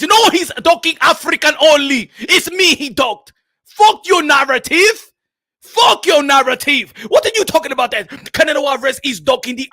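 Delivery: loud African boxer on a furious rant; hammed-up outrage, heavy African accent; Makabu. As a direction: shout down the doubters. Makabu rants about being ducked.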